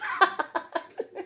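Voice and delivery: high breathy cackle of a laugh; crazy laugh. Real, not acted. Laughing at herself over buying chicks, crazy laugh. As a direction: burst into a giddy laugh at yourself. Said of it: "Yeah, the crazy laugh".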